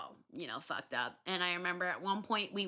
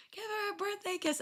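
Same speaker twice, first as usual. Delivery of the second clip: usual speaking voice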